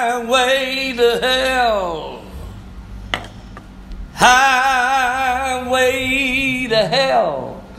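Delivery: very slow, dragged, stretched words; drawn out. Acted. Preacher to congregation warning of hell, drawn slow. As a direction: let each word hang ominously.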